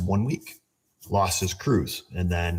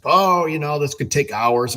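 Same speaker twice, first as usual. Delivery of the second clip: dealer brush-off; 6 semitones higher, 4 dB louder than usual. Retold or imitated. Dealer stalling on the phone.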